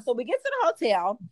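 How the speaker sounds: ordinary delivery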